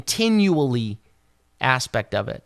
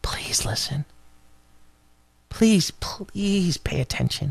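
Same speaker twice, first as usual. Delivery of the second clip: whispered plea, slow and hushed; a hushed, drawn-out please; soft pleading; 2 semitones higher, slower than usual. Acted. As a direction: beg them quietly to listen. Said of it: "as with this pleading".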